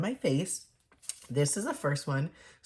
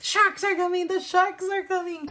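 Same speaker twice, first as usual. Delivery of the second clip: much higher, louder panicked cry; panicked partygoer; 4 dB louder than usual. Acted. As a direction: squeal the warning like a frantic fish. Partygoer runs in with warning.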